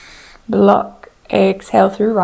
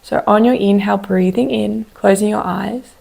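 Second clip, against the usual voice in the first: ordinary talk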